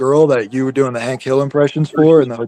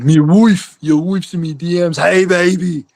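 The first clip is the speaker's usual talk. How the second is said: higher, goofy put-on voice; raised-pitch mock voice; put-on silly voice; 4 semitones higher than usual. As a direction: ham up a silly character. Continues the put-on voice.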